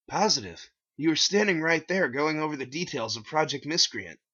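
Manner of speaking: confident clerk voice; cashier voice. Acted. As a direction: insist cheerfully. Cashier insists.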